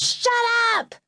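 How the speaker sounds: put-on very high, drawn kid's shriek; scream. A narrator's reading. Youngest brother at the squabbling family table, screaming. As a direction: scream it high to silence everyone. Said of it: "And Bruce screamed".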